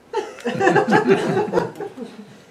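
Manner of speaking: a high loud burst of laughter. Real, not acted. Driving off free, loud laughter. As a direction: crack up again.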